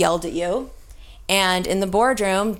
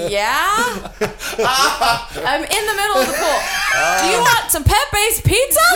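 calling across the pool; 11 semitones higher, 3 dB louder than usual. Retold or imitated. Shouting across the pool, laughing.

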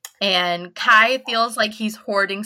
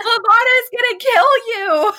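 high, loud, panicked warning shout; acted outburst at top pitch; yelling; 13 semitones higher, 5 dB louder than usual. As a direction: yell a frantic warning, hammed up. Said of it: "he just yells".

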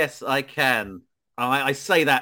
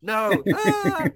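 high, mocking screams of the villain; imitating a high panicky scream; mocking screamer; 10 semitones higher, slower than usual. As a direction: mimic him shrieking back. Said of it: "He goes".

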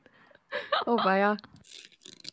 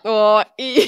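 stifled giggles; 6 dB louder than usual. Real, not acted. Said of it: "Ich muss ein bisschen lachen".

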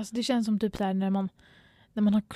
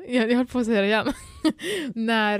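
ordinary talk

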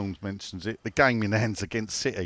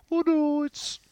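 high, droning mock Brummie; Brummie accent; slower than usual. Pundit mocking the accent he hates, exaggerated Brummie. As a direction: put on the dreadful Brummie whine. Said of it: "That Brummie accent's gotta be the worst".

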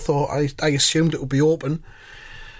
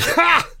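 high, sharp "Ha!"; triumphant bark of a laugh; 12 semitones higher than usual. Retold or imitated. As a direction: crow over him.

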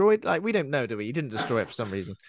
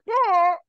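high, loud squeal; imitated distorted-face noise; 6 dB louder than usual. Podcaster mimicking a face turning to static, high squeal. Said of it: "his face goes all like".

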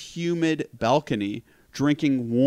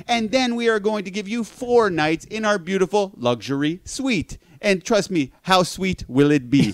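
hammed-up phone scammer sweet-talking a mark; scam caller imitation; 6 semitones higher than usual. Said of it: "being like".

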